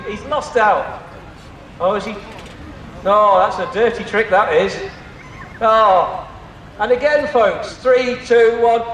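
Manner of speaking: normal talking voice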